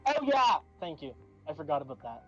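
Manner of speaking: normal talking voice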